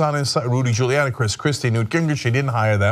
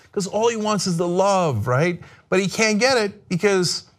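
usual speaking voice

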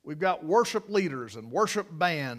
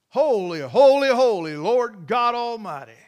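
angels crying out; 3 semitones higher, 8 dB louder than usual. Retold or imitated. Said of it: "That's what they cry".

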